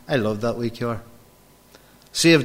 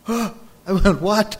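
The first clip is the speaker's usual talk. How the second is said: gasp, then a high startled what; shocked gasp; 8 semitones higher, 4 dB louder, slower than usual. Retold or imitated. Retelling the mechanic's news, high shocked gasp. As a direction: imitate his own shock at the news.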